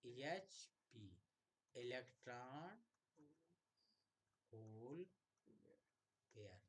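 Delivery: ईएचपी expanded word by word, slowly; slow spelled out. Shown as a demonstration. Teacher slowly expanding ईएचपी for students. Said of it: "ईएचपी माने".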